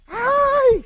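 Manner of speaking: high, loud acted squeal; sudden piercing "ay"; startled shriek. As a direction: yelp in excited surprise. Acts Rhodes' startled cry at Pedro.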